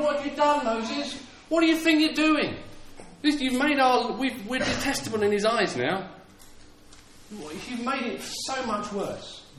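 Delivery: high-pitched crowd grumble, acted out; mock moaning complaint. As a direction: imitate people wailing blame at their leader. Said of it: "The people started moaning".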